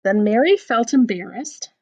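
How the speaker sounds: lively storybook reading with voiced bits; picture-book read-aloud; reading to children. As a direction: read the silly poem to a child. Reads a funny rhyme book aloud.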